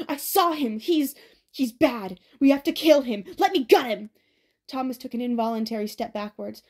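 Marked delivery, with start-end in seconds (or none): 0.1-4.1 raised, frantic shouting with a halting middle; shouted. A narrator's reading. Crazed Ben urging Albie to let him kill Thomas, shouting. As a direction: shout wildly, begging to attack. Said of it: "Ben shouted".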